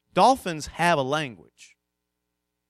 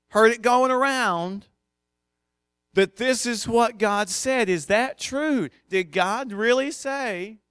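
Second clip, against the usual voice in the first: higher, louder, slow and coaxing tempter voice; sly serpent; 6 semitones higher, 4 dB louder than usual. Acted. Serpent slyly questions Eve about God's word, in a higher, louder, slow coaxing voice. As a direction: plant the doubt like a gossiping snake. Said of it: "the snake looks at Eve and says".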